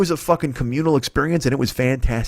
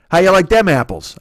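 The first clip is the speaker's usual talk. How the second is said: loud, higher hammed-up Boston accent on "How you like them apples"; 6 semitones higher, 5 dB louder than usual. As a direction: punch it like a brash Boston guy. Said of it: "I can't do a Boston accent, but I'll try".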